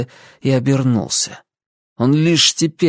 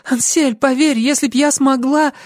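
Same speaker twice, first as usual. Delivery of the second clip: high-pitched entreaty; pleading young woman; 14 semitones higher than usual. A narrator's reading. Lucy begging Ansel to believe her, pleading. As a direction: beg him in a much higher voice.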